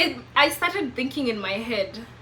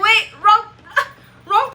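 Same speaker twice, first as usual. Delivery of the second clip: high, loud playful panic; mock-correcting oneself, breaking into laughs; laughing mock alarm; 10 semitones higher, 8 dB louder than usual. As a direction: blurt it out through laughter. Acts out her alarm, laughing.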